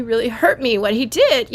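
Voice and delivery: normal talking voice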